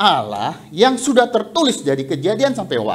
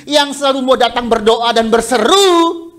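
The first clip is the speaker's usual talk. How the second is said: louder, higher preaching surge; preaching rise; 6 semitones higher, 6 dB louder than usual. Acted. Preacher urging the congregation to keep praying, louder and higher. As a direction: lift it up and call it out to the church.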